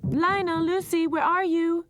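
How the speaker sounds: very high, loud call. A narrator's reading. Mother cat calling her missing kittens, loud. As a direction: shout across the garden.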